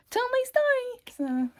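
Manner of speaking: drawn-out high exasperated plea; strained exasperation at noisy friends. Real, not acted. Hushing friends mid story.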